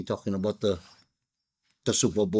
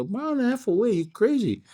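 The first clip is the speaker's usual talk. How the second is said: high, disbelieving mock shock; can't believe what you just heard; pitched way up in playful disbelief; incredulous; 12 semitones higher than usual. Teller shocked by far parking.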